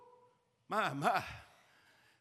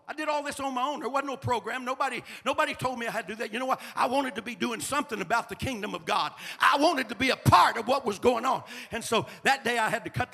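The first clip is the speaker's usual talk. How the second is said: rising, louder sermon voice; preaching; 6 semitones higher, 11 dB louder than usual. Acted. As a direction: preach it out with fire. Preaching full force.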